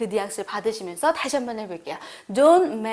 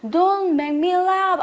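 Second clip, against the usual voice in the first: higher, louder, on the edge of laughing; amused; 7 semitones higher, 4 dB louder, much slower than usual. Shown as a demonstration. Someone cracks you up, voice bubbling with laughter. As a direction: say it like the joke is too funny to stand. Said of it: "나 웃기게 하지 마".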